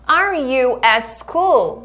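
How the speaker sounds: very slow, drawn-out teacher demo; slow-motion classroom question; model for repeating. Shown as a demonstration. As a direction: stretch each word for learners to copy.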